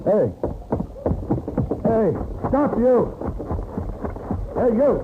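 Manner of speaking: shouting after him up the street; calling out. Acted. Paladin shouting after the man driving off with his wagon. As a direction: yell high to stop the thief.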